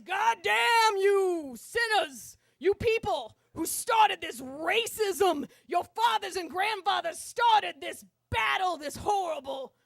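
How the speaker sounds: imitated subway preacher, loud and drawn out; fire-and-brimstone preacher, stretching the stressed words; booming sermon voice. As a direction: thunder slow damnation at the sinners. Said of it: "continues his sermon".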